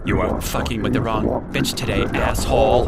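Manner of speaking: loud furious snarl; scary ghost voice. Shown as a demonstration. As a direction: threaten like an enraged ghost. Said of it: "I'll give you an example".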